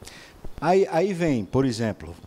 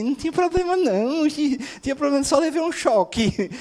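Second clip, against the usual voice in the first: very high, childish imitation; mock baby voice; 10 semitones higher than usual. Baby who poked the socket making excuses, mocked in high voice. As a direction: play a silly baby insisting all is fine, pitched way up. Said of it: "O bebê que bota o dedo na tomada e diz assim".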